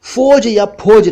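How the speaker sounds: फौज high, as "foj" then "phoj"; mispronounced फौज. Shown as a demonstration. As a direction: say फौज wrongly with rounded "o", first f, then aspirated p. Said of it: "फौज या फौज नहीं".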